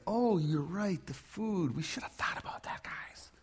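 whispered mock-oblivious aside; sheepish whisper. A mock Jesus whispering sheepishly to the guys about the food. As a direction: whisper it like you just noticed.

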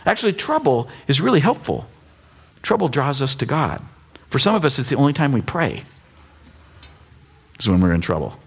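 usual speaking voice

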